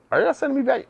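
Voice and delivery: sudden pitch jump in disbelief; incredulous question. Retold or imitated. Realizing his friends are sending him around, disbelieving. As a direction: catch on that they're playing you.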